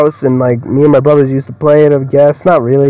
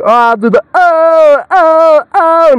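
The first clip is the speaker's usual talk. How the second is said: much higher, playfully imitating a keen performer; mock eager voice; 13 semitones higher, slower than usual. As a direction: squeak it like the excited guy. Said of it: "the guy was like".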